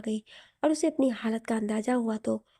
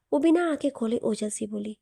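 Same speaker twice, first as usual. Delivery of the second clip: ordinary delivery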